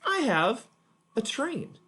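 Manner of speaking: put-on high, bright make-believe kid; playful child voice. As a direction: pretend to be a kid showing off a toy. Teacher plays child with toy.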